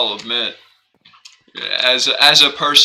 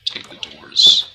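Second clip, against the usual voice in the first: whispered; 7 semitones lower, 4 dB louder, slower than usual. Real, not acted. Low unvoiced repeat.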